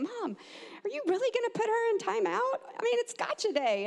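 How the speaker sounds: put-on high, imploring kid voice; pleading son. Son questioning Mom about the timeout, high and pleading. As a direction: plead with Mom on a sibling's behalf. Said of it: "I remember one of them saying".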